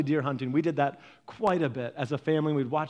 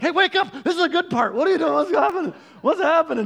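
high, loud, urgent pestering; mock-yell like dad rousing a dozing passenger; high-pitched excited shouting, imitating dad; imitating dad's wake-up shout; 12 semitones higher, 7 dB louder than usual. Acts dad waking sleeping mom on trips.

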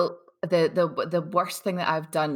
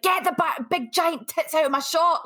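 high mock husband order; pitched way up, playful husband complaint; mock husband shouting; 10 semitones higher than usual. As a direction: bark the order like the annoyed husband. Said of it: "he's like".

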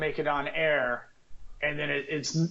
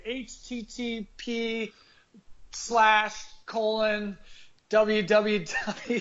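slow spelled URL; 5 semitones higher, much slower than usual. Shown as a demonstration. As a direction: slowly spell each letter. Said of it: "if you wanna go the other way".

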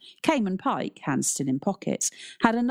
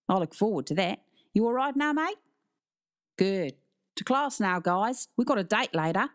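upbeat, matey Aussie coach; friendly Australian teacher; Australian accent; 4 semitones higher than usual. A narrator's reading. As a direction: send the pupils off to class in an Australian accent. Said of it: "It was Australian".

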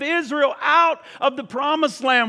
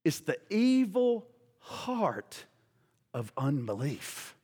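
much softer, slow, sinking low; hushed and grave; 8 semitones lower, 10 dB quieter, slower than usual. Acted. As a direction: drop to a slow, grave hush. Dramatic, dropped emphasis.

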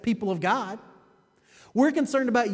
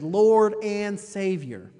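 "and" held long and slow in "Lord and Savior"; slow, deliberate weight on "and"; stress on "and"; 4 dB louder, much slower than usual. Shown as a demonstration. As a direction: stretch out the word "and" for emphasis. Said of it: "Emphasis on the and".

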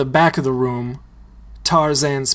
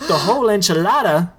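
fast, higher, stunned question; quick, higher gasp of disbelief; shocked echo; 6 semitones higher, much faster than usual. Acted. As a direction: repeat it in disbelief. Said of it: "Tito repeats".

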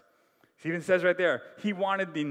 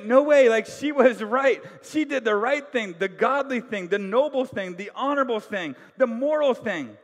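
emphatic agreement; 4 semitones higher, 6 dB louder than usual. Retold or imitated. Congregation voiced agreeing.

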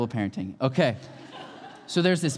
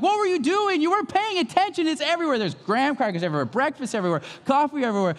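much higher, frantic parental yell; dad scolding his daughter over spilled coffee, high frantic yell; exasperated yelling; 12 semitones higher than usual. Retold or imitated. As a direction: shriek at your kid over the mess. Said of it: "and I'm screaming".

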